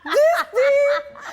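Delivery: high, loud squeal through laughter; cracking up, voice shooting up; laughing hard. Real, not acted. Cracking up with co-host.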